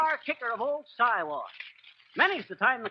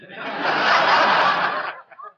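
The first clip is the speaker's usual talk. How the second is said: breathy chuckling laugh; wheezy laugh; 7 dB louder than usual. Real, not acted. Laughing at his own window-trimmer brag, breathy chuckle.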